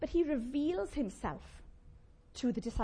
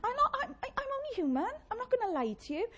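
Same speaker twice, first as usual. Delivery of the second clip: high-pitched mock-defensive admission; squeaky, stammering self-excuse; playful confession; 11 semitones higher, faster than usual. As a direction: plead it high, as a sheepish excuse. Playful confession of liking gossip.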